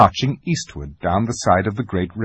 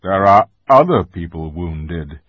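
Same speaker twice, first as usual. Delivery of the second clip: louder, measured authority; stern lion; 3 semitones lower, 7 dB louder than usual. A narrator's reading. Aslan reminds Lucy of the other wounded, stern. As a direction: remind her firmly of her duty.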